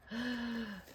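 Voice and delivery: quick playful gasp; mock-shocked gasp. Real, not acted. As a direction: gasp at the missing ruler. Daughter stole the ruler.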